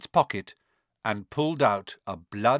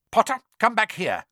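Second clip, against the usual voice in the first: raised, loud order; shouted; 7 semitones higher, 5 dB louder than usual. A narrator's reading. Quirrell calling the fleeing boy back, shouting. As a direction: call him back sharply, high and loud. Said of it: "Quirrell shouted".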